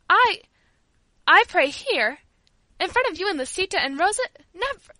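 high, startled protest with pauses; dismayed. A narrator's reading. Servant refuses to pray before the girls, dismayed. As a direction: balk at the very idea, aghast. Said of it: "looked around her in dismay".

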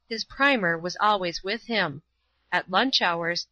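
ordinary delivery

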